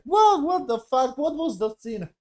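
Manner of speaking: high yelp of disbelief settling down; mock baffled. Reviewer mocking the scene's twist, high shocked yelp. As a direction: start with a high shocked squeak, then trail off. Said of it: "it was like".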